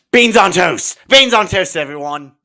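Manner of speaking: gasp, then a high, loud cry; excited shout. Acted. Host calling everyone to beans on toast, excited shout. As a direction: announce it like a thrilling revelation.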